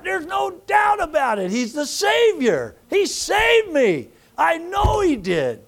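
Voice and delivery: high, slow, drawn-out preaching cry; impassioned declaration of faith to a congregation; fervent testimony. Shown as a demonstration. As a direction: stretch each key word and proclaim it. Models a fervent witness testimony.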